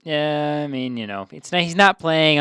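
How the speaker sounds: ordinary delivery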